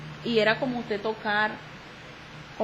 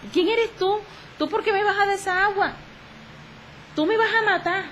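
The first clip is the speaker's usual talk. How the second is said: much higher, frightened accusing voice of a father; imitating confused father; 11 semitones higher than usual. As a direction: voice the father's fear that she'll kill him. Confused father talking to daughter.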